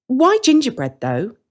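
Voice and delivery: put-on high, boyish hesitant question; a cautious boy checking politely; Hansel's voice. Young Hansel asking the woman, high and careful. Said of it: "Hansel asks".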